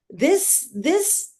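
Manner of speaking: a high, rising divine voice, lightly acted; voice of Krishna. Krishna answering a crying mother in her heart, high imitated voice. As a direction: lift each word high, as a god heard inwardly. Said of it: "heard Krishna in my heart".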